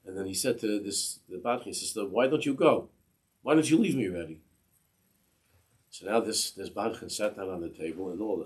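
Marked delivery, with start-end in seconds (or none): 1.9-4.4 loud, raised challenge; retold yelling. Rebbe yelling at the badchan to leave, loud and high. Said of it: "And he starts to yell".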